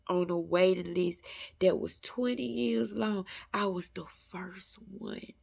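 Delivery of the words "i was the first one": whispered, awed testimony; hushed awe. Real, not acted. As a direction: let it fall to a hush of wonder.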